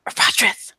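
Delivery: loud, urgent-to-carry stage whisper. Acted. Calling into the lodge for a companion, stage whisper. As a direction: whisper it hard so it carries into the room. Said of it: "almost a stage whisper".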